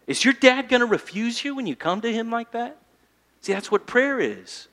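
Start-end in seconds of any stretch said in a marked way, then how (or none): none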